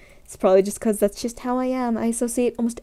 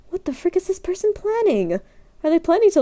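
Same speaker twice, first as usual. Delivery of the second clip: baffled; 8 semitones higher than usual. Retold or imitated. Onlookers baffled by the purchase.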